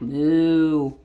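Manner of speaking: low, drawn-out moo; cow moo. Acted. Teacher voicing the typing cows, low moo.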